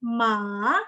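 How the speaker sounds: drawn-out "ma" with a valley in the middle; third-tone MA. Shown as a demonstration. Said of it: "Tapi ton tiga".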